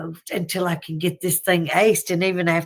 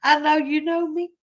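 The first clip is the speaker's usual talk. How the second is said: higher, mock-guilty plea to the preacher; sheepish apology; 8 semitones higher than usual. Head bowed in church, apologizing to the preacher, higher and mock-guilty. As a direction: play it like a caught churchgoer apologizing. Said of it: "sitting there with my head down like".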